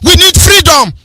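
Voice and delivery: high-pitched, forceful political speech; impassioned rally oratory. Real, not acted. Radio host rallying listeners for Biafra, impassioned oratory. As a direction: drive it home like a rally speaker, pitch climbing.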